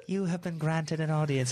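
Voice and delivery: a hint of a grand herald; mock-regal announcement, laughing through it; lightly voiced villain. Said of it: "He's way cooler sounding than that".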